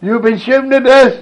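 high, loud indignant cry broken by laughter; laughing outrage. Retold or imitated. Corporal protesting to the trench, loud high laughing cry. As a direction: laugh while shouting the complaint.

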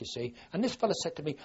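usual speaking voice

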